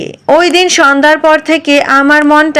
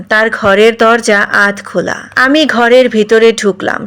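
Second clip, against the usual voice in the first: plain reading voice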